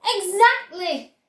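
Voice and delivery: delighted. A narrator's reading. Wonka confirming to Charlie, delighted. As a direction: savour the word with glee.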